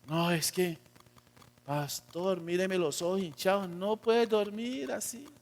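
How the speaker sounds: sleepy churchgoer. Acted. Churchgoer shows pastor puffy eyes, mock sleepy pleading. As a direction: plead quietly like someone barely out of bed.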